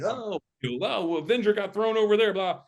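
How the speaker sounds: high-pitched sham reaction; hammed-up, high mock alarm; mocking fake action acting. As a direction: parody an actor pretending at a blue screen. Mocks blue-screen Marvel acting.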